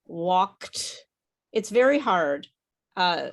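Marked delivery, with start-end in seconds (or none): 0.1-1.1 "walked" ending in a kt made at the back of the throat; final kt sounded. Shown as a demonstration. Teacher models for student, "walked" with a back-of-throat kt ending. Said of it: "kt at the back of your throat".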